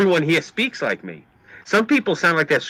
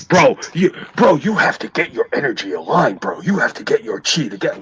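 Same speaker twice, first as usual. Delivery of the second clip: mock California surfer-dude accent; earnest Californian bro, hammed up. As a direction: parody a California bro talking chi. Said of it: "Why the fuck do they all talk like that in California?".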